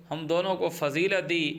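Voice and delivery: usual speaking voice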